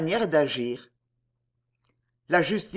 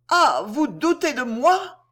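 slow, higher and louder cry of hurt; anguished cry; 6 semitones higher, 4 dB louder, slower than usual. A narrator's reading. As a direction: cry out, heartbroken at being doubted. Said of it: "en criant avec un accent qui bouleversa".

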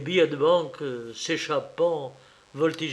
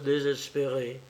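usual speaking voice

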